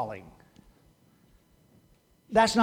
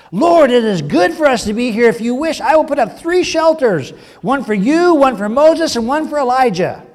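higher, louder excited plea; eager Peter; 7 semitones higher, 10 dB louder than usual. Acted. Peter offering Jesus three shelters, high and eager. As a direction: Peter bursting to help.

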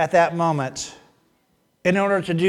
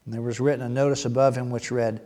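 normal talking voice

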